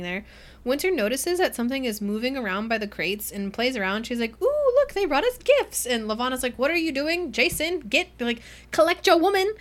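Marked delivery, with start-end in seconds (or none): 4.4-5.8 very high, sing-song and thrilled; dreamy delight. Retold or imitated. Winter finding the crates, in a very high delighted squeal. 6.6-9.5 exasperated command. Retold or imitated. Levana scolding Jason.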